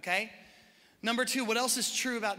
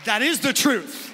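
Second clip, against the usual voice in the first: much louder, slow, declaring each word; emphatic proclamation; 10 dB louder, slower than usual. Real, not acted. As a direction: proclaim it to the whole church. Preacher proclaims to congregation.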